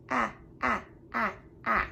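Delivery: "ah" as short, separate vowels like the A in apple; short A sound. Shown as a demonstration. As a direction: sound out each "ah" briefly on its own. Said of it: "is the short A sound".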